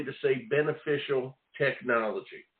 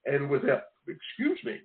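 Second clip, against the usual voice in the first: ordinary talk, nothing marked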